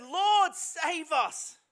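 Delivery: high, loud panicked cry; terrified disciples. Retold or imitated. Disciples in the storm wake Jesus, panicked high plea. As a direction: cry out for rescue, voice shooting up. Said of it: "And they said".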